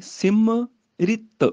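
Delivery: ਸਿਮਰਿਤ drawn out very slowly, the i sound on ਰ; slow careful ਸਿਮਰਿਤ; careful pronunciation. Shown as a demonstration. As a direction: spell out ਸਿਮਰਿਤ slowly with ri, not mi. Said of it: "ਉਹ ਰਾਰੇ ਦੀ ਉਚਾਰਨ ਹੋਏਗੀ".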